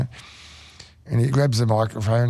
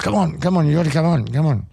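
higher, pushy coaxing; retelling a mate urging him to get up; urgent coaxing; 6 semitones higher than usual. Retold or imitated. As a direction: hurry a reluctant mate along. Mate urging singer onstage.

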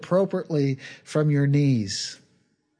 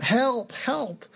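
high-pitched retold cry for help; screaming for help; 8 semitones higher than usual. Hiker dangling from a branch, calling out in a high mock scream. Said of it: "he just started screaming out".

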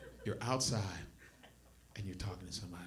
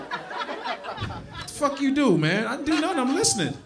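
mock bickering friends; 11 semitones higher than usual. Friends bickering over smell.

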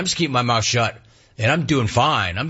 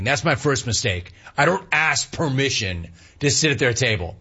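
ordinary talk, nothing marked

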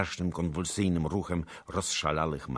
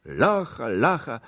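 higher, louder repeated call; crowd calling out; 8 semitones higher, 8 dB louder, slower than usual. A narrator's reading. Some council members clamour for the prisoner, calling out. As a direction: call it out urgently. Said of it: "Jedni wołali".

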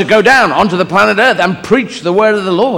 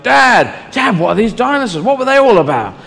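higher-pitched, pestering son; Jesus questioning Dad; 4 semitones higher than usual. Acted. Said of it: "Jesus must have asked a few questions like".

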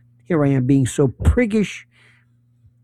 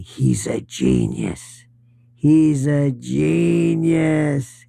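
slow, lower, sarcastic drawl of "He's a genius"; mock praise; 3 semitones lower, much slower than usual. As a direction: drag it out, dripping with sarcasm. Mocking quote of Mamdani's critics.

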